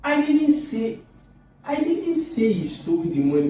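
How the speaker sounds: I DIDN'T thrown very high and loud; emphatic denial. Shown as a demonstration. Teacher repeating the same words with new stress, high emphatic denial. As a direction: start high on I DIDN'T, then drop. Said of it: "say with the same set of words".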